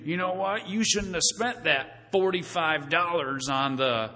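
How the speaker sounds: slow, nosy tut-tutting; lightly voiced busybody; mock scolding. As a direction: wag a finger at their spending.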